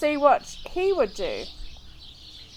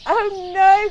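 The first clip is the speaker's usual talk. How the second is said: higher, louder dismayed groan; 7 semitones higher, 7 dB louder than usual. A narrator's reading. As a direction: groan it out in dismay, up high and loud. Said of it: "groaned Will".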